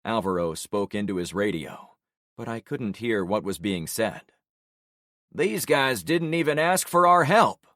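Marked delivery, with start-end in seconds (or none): 5.3-7.7 higher, louder wry remark; Shane's remark. Acted. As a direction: grumble it mid firefight. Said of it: "Shane remarked".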